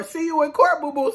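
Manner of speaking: high mock send-off; taunting. [name] gloating as she leaves Sarah, taunting.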